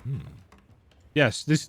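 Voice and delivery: ordinary talk, nothing marked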